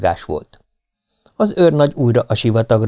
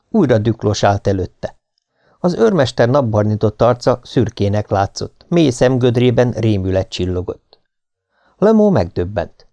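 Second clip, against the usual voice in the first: ordinary delivery, nothing marked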